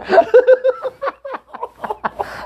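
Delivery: high-pitched burst of laughter; high laughing fit. Real, not acted. Laughs at joke.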